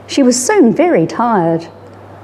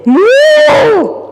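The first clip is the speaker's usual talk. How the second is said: a much higher, louder startled moo; alarmed moo; 6 dB louder than usual. Acted. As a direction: cows crying out at the flood. Wall of water hits.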